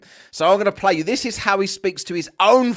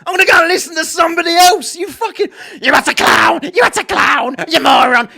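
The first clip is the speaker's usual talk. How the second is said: louder, higher, furious shouted telling-off; 8 semitones higher, 7 dB louder than usual. Pretend. A viewer finally telling Nathan Oakley off, in a furious shout. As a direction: scream him down like you've finally snapped. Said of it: "One of you people to say".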